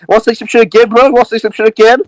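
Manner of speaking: high-pitched, heated threat; aggressive challenge. Acted. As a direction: challenge him like you've grabbed his shirt. Grabs and slams man, threatening him.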